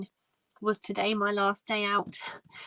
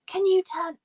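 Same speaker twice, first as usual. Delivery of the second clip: high, loud demand in imitation of an irate stranger; retold aggressive demand; 12 semitones higher, 6 dB louder than usual. Stranger demands the phone be turned down, imitated as aggressive. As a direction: put on a high, pushy voice for his demand. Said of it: "he got really aggressive".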